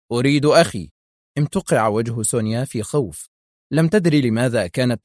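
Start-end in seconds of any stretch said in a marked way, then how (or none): 0.1-0.9 firm, slightly raised demand; stern. A narrator's reading. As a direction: state the demand with understated severity. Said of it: "قائلا في صرامة". (بصرامة)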